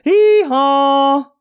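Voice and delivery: high, loud, sharp hee-haw; shrill donkey bray. A narrator's reading. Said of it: "a shrill and sharp".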